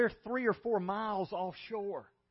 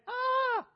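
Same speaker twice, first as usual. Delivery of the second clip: terrified cry; 4 dB louder than usual. Retold or imitated. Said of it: "they cry out in fear".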